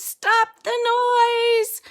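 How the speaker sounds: very high, loud screech; screeching bat. Acted. Bat overwhelmed by echoing singing, high loud screech. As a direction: scream it through the racket. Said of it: "he screeches".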